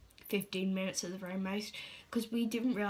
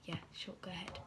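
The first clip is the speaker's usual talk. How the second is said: soft whispered permission; 3 semitones lower, 12 dB quieter than usual. Retold or imitated. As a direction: breathe it out in a low whisper. Mum's reply, whispered retelling.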